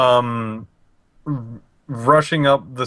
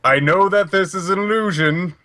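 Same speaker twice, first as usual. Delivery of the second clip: mildly raised, challenging call; acted loud declaration, only moderately raised; 3 semitones higher than usual. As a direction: call out a hidden trickster, pitched a bit up. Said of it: "He's just gonna be very loud about this".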